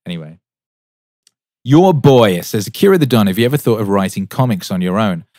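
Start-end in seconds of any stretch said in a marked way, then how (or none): 1.6-5.2 loud, slowed opening; reading a viewer's question to the stream, loud; loud announcing read. Real, not acted. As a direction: call out the chat question like a host. Reading chat comment aloud.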